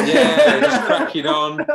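high, loud, laughing echo of the catchphrase; laughing mockery. Real, not acted. Mate teases host's catchphrase, laughing high and loud. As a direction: laugh it out as you tease the host.

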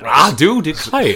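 put-on loud, drawn-out Southern accent; hammed-up Southern gentleman; slow, laughing Southern drawl. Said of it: "Just doing a Southern gentleman".

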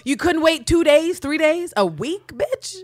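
high, loud, fed-up rant at a character; exasperated rant. Real, not acted. Podcaster ranting at Nico on screen. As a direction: tear into him for his bad timing.

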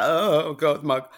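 loud, hammed-up wail; mock weeping. Patient shows the weeping she didn't do, mock wail. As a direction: imitate someone sobbing out loud. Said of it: "I wasn't weeping, you know, like".

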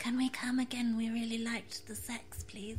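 softening to near silence; sweet, fading plea. Retold or imitated. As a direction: ask it shyly and fade out. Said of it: "they're all quite like".

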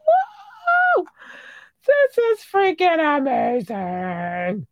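very high squealed whoa, slowly stretched out; over-the-top excitement. Acted. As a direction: shriek it and draw out every word. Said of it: "I get excited about it".

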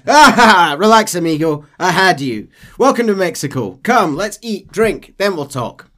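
loud, high hearty captain; captain welcoming John after the joke; jovial captain. Acted. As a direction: slap him on the back and laugh it off. Captain laughs off the prank.

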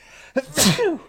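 a loud real sneeze; big sudden sneeze out loud; sneezing. Real, not acted. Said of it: "I'm gonna sneeze here".

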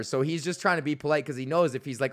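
ordinary talk

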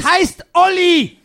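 loud, slow, offended correction; indignant shout. Acted. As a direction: bellow the name back. Correcting his name angrily.

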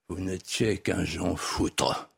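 slow, low, understated scorn; imperial contempt. Retold or imitated. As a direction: deliver a measured insult as the Emperor, word by word. Emperor's contemptuous verdict, acted. (mépris impérial contenu, lent et grave)

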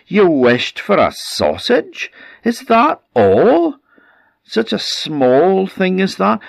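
furious husband. Acted. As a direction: berate her for wasting a wish. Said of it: "the man was furious".

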